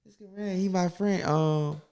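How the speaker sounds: high, slow sing-song introductions; lightly airy fake-friendly intro voice; mock partner at family gathering. Mocking a partner introducing him to the family, high and slow.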